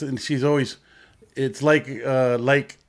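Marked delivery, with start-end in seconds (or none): none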